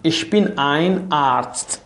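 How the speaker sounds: very slow, deliberate German sentence; slow model answer. Shown as a demonstration. Teacher modelling for students, slow careful answer. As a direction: lay each word out slowly for learners. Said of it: "teacher models German answer".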